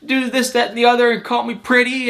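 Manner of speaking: high, sustained mock-tearful voice; lightly hammed-up hysterical pleading; imitated sobbing complaint. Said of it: "then she's just sobbing".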